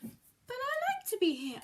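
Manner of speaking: high, loud cheeky reply; cheeky cat. Acted. Cat answering the fish back, high and cheeky.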